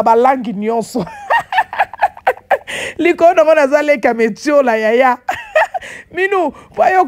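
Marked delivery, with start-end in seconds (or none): 1.1-2.9 mocking laugh. Real, not acted. Mocking the sister's French. 5.2-5.9 mocking laugh. Real, not acted. Mocking the sister's pretensions.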